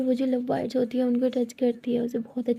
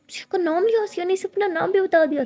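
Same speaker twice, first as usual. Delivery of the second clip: higher, louder jealous outburst; jealous anger; 7 semitones higher, 4 dB louder than usual. Acted. Tara fumes at Siya for giving her name, jealous outburst. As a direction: snap it out, stung and jealous.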